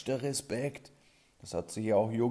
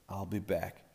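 cool movie hero; 4 dB quieter than usual. Pretend. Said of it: "Wie der Marlboro Man".

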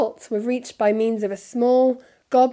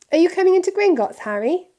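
excited; 10 semitones higher, 7 dB louder, faster than usual. A narrator's reading. Excited Hermione.

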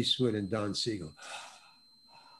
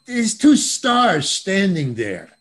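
ordinary talk, nothing marked

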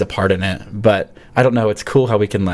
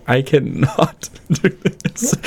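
drawn-out, slightly higher mock despair; exasperated protest; 6 semitones higher, much slower than usual. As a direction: stretch it out in laughing self-doubt. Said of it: "I was like".

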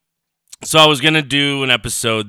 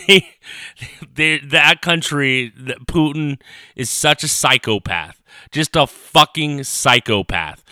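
ordinary delivery

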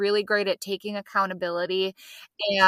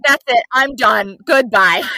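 louder, curt fed-up husband; 9 dB louder than usual. Retold or imitated. Husband quits the reality show, a hint of fed-up. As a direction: storm off done with it. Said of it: "He's like".

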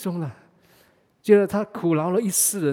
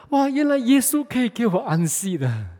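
mildly amazed, pitched up on the realisation; higher-pitched mock astonishment; 6 semitones higher, 4 dB louder than usual. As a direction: retell a sudden, relieved discovery. Old man's retold moment of realisation.

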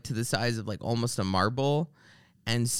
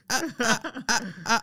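phone woman; 9 semitones higher than usual. Retold or imitated. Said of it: "And she's like".

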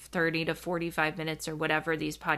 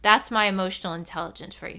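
usual speaking voice

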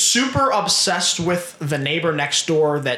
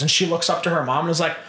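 ordinary talk

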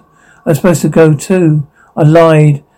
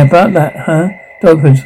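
normal talking voice

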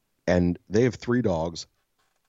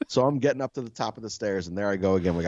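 ordinary delivery

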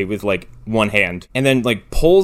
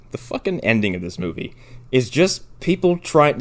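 normal talking voice

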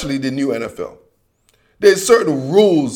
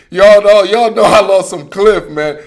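laughing; 4 semitones higher, 6 dB louder than usual. Real, not acted. Laughs about loving Cliff Kingsbury.

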